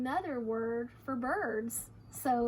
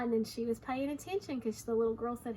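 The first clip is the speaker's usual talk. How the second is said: usual speaking voice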